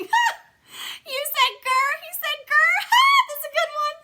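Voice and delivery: very high, loud laughing shout. A narrator's reading. Herb cracking up at Junie B's growl, loud laughing shout. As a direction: laugh through the words. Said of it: "Herb kept on laughing".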